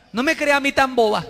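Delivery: high-pitched offended retort; put-on affronted protest; mock indignant wife. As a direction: reject the apology in hammed-up indignation. Offended spouse rejects apology, acted.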